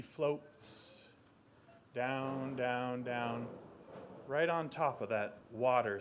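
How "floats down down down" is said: slow floating. A narrator's reading. Feather floating down.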